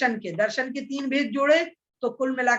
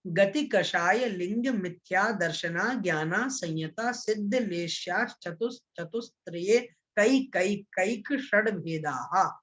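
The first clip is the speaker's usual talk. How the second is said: slow, connected sutra chanting without long breaks; all-together recitation; slower than usual. Shown as a demonstration. Teacher reciting the whole sutra again, in one connected flow. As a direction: recite the verse straight through, measured and even. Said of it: "एक साथ इसका उच्चारण".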